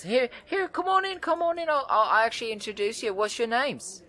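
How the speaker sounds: very high, loud, bubbly woman's voice; excited red-haired woman. Acted. Wiry red-haired woman greets the adventurers, very high and thrilled. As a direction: bounce in thrilled to meet them. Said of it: "and says".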